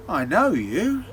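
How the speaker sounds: slow, listing innkeeper, rising to a refusal; suspicious innkeeper. Acted. Innkeeper recognising the devil, lightly voiced suspicion building. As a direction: spell out each feature, then turn on him.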